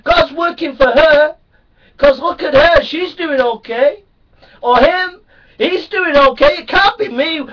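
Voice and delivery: a hint of a despairing listener, loud and high; doubting churchgoer. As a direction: plead that God has forgotten you. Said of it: "You say".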